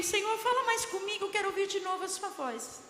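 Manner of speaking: high, earnest plea to the Lord; pleading prayer. Retold or imitated. Retelling her plea to God. (súplica em tom agudo)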